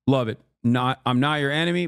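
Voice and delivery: ordinary delivery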